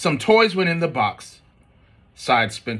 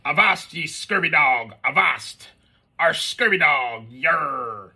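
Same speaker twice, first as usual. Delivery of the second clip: bellowing pirate; 2 semitones higher, slower than usual. Acted. A pirate toy bellowing by mom, hammed-up pirate shout. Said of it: "an egg-shaped pirate that bellowed".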